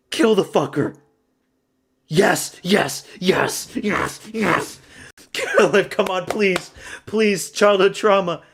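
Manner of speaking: excited fan shouting at the screen; urgent cheering. Real, not acted. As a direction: beg your fighter to finish it. Urging fighter to finish.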